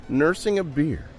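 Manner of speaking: ordinary delivery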